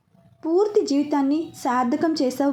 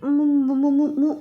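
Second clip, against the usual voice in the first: muffled struggle. A narrator's reading. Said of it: "అంటూ గుంజుకుంటోంది".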